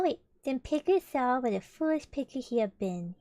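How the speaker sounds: ordinary delivery, nothing marked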